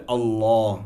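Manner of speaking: আল্লাহ said "Allaw", rounded and long; heavy "law". Shown as a demonstration. Teacher applying the rule, heavy "law" in আল্লাহ. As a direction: make the l of আল্লাহ heavy, vowel "aw". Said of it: "সেখানে হবে".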